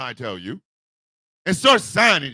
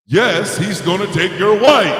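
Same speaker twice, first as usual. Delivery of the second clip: loud gloating; 3 semitones lower, 7 dB louder than usual. Retold or imitated. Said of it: "it's like this".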